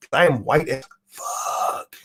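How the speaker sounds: slow, hammed-up fake sob; mock crying. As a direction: wail it like a mock tragedy.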